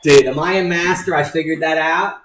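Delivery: loud, mock-proud boast; hammed-up bragging. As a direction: brag like a show-off. Bragging about his discovery.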